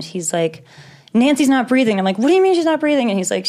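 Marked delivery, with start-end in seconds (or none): 1.1-2.0 quoting dad's panic, a bit higher and louder; panicked dad. Retold or imitated. Dad on the phone, saying mom isn't breathing, panicked. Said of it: "he's complete panic state". 2.2-3.1 shocked disbelief. Retold or imitated. Hearing mother stopped breathing.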